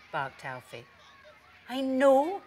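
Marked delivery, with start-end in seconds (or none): 1.7-2.4 higher-pitched dog character; gently emphatic storybook reply; Pepper. A narrator's reading. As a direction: answer in a raised, knowing voice. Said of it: "barked Pepper".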